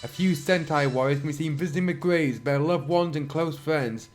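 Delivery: normal talking voice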